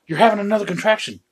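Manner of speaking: mock-helpful high-pitched husband; chirpy announcing. Acting himself announcing wife's contraction.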